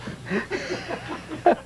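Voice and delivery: high, airy burst of laughter; laughing at own joke. Real, not acted. Host laughing at his own [name] joke. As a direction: cackle at your own punchline.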